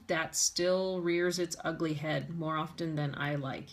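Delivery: usual speaking voice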